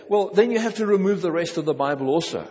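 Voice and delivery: normal talking voice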